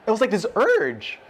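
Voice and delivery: ordinary delivery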